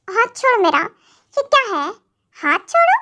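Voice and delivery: sharp rising protest that peaks high on the last छोड़ो; acted pained cry; let go of my hand. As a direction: yelp at him to let go. Wife's wrist twisted in a quarrel.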